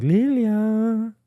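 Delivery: name sung out high and sweet; sweet coaxing call. Pretend. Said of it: "If I was like".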